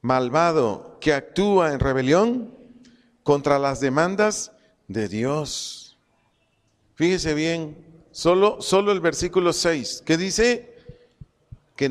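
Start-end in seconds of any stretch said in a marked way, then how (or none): none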